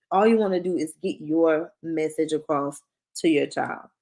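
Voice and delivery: ordinary talk, nothing marked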